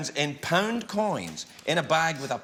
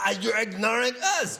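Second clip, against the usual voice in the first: higher-pitched mock French accent; 5 semitones higher than usual. Host mimicking snubbed French people, mock French accent. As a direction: put on a comic French accent that veers German. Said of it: "You think my French accent sounds German".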